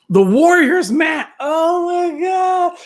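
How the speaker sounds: high, loud burst of real excitement; excited shout. Real, not acted. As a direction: shout it out, thrilled to see the title. Excited reaction to a comment.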